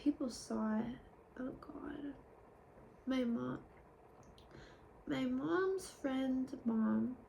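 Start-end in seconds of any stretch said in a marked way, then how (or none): 1.4-2.1 breath-only, under the voice; a hushed cringing aside; whispered oh God. Real, not acted. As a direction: whisper it in embarrassment. Embarrassed aside.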